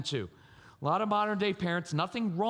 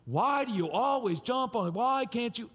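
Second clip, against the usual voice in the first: higher-pitched whiny parent complaint; exasperated personal reproach; 5 semitones higher than usual. Acted. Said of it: "but it's not personal".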